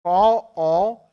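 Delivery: loud, slow "कॉ-ओ-ने-टे-रे-आ"; syllable breakdown. Shown as a demonstration. Teacher spells out the sounds for students. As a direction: break the word into syllables slowly and loudly. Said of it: "syllables spelled out".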